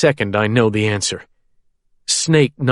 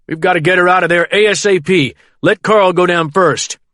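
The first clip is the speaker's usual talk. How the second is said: quick, raised, pressing; urgent; 6 semitones higher, 3 dB louder than usual. A narrator's reading. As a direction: press the crew to act at once. Pilot urges immediate rescue.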